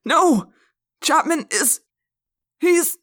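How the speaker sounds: high, loud, desperate outburst; crying out. A narrator's reading. Stricken teacher exposing assistant principal, crying out. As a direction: cry a warning that breaks off. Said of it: "Mister Pardew cried".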